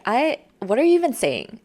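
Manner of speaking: sharp mock disbelief at a friend. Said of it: "We get sharper and we'll be like".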